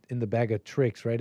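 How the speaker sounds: ordinary talk, nothing marked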